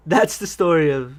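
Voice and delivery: ordinary talk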